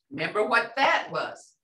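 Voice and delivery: ordinary talk, nothing marked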